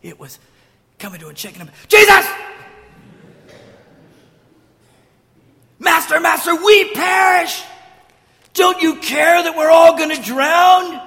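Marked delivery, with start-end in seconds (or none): 1.9-11.0 high, full-volume desperate yelling; panicked shout. Acted. Disciples shaking Jesus awake as they drown, panicked shouting. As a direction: scream for help over a storm.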